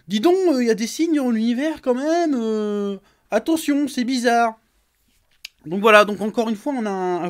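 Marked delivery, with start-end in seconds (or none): 0.1-4.6 mocking the journalist. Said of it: "il dit que".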